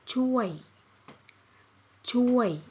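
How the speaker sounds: chuoi said with a falling Thai tone. Shown as a demonstration. Teacher models chuoi with a falling tone for students. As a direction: let chuoi fall in pitch. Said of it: "falling tone".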